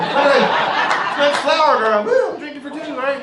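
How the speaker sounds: put-on high, laughing, slow party-girl voice; mock drunk bride. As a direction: whoop it up as the pregnant bride.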